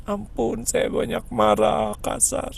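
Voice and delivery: high, rising to a choked near-sob plea for forgiveness; tearful prayer. Retold or imitated. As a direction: pray for forgiveness, breaking toward tears. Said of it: "tapi bicara ke Allah".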